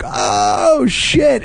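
swooping high mock gasp of shock; retold crowd reaction. Imitating the audience after the punchline, high mock-shocked cry. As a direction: imitate a whole room reacting, leaping up high. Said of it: "made everybody like".

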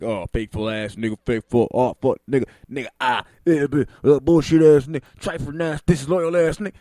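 loud, mocking voice of a Twitter hater; mock Twitter insults. Mocking Twitter hater, hurling insults. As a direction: sneer the insults like an online beef. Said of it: "just be like".

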